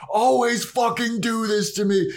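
imitating a ranting vampire; lightly hammed-up outrage; mock angry rant. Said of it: "he just starts fucking screaming and he is pissed".

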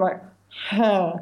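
ordinary talk

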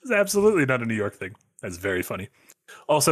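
ordinary delivery

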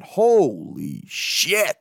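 hugely drawn-out amazement; slow, marvelling exclamation; awestruck. Real, not acted. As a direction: stretch each word in awe. Marvels at the second home run.

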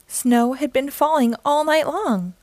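ordinary delivery

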